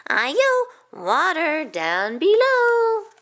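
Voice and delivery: high call with a long drawn-out finish; rice cake cry. A narrator's reading. Rice cake tumbling over the docks, high drawn-out cry. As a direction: stretch the warning out high. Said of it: "It cried".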